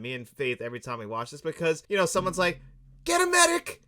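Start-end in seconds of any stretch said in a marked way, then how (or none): 3.0-3.8 high, loud cry for help; mock panicked shout. Bystander calling for help after a shooting, mock panicked shout. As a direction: call out urgently, imitating the film.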